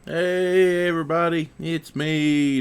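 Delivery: usual speaking voice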